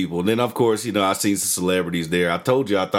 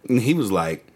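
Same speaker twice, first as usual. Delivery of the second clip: plain reading voice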